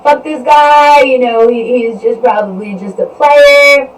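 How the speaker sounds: retelling her fed-up rant at the guy; annoyed outburst. Retold or imitated. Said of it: "she was like".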